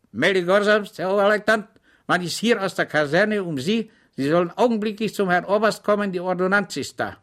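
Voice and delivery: high, loud, hurried military report; eager batman report. Acted. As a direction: snap out the message dutifully. (eifrige Meldung des Burschen)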